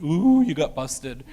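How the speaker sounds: slow sing-song schoolkid taunt, lightly voiced; class teasing. Whole class taunting the caught student, slow mocking ooh.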